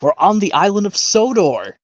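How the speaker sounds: high, bright, triumphant call; excited Lady. Retold or imitated. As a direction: announce the arrival with delight. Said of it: "And Lady exclaims out".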